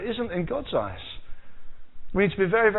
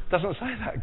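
usual speaking voice

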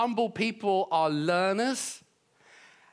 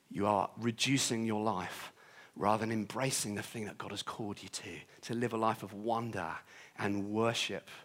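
hushed, lower and softer, drawing them in; intimate quiet preaching; 8 semitones lower, 7 dB quieter than usual. Acted. Soft reflective close of sermon.

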